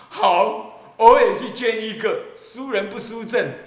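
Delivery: not to be outdone, pledge loudly; eager competitive donor. Acted. Monk voices a donor who won't be outdone, loud and eager.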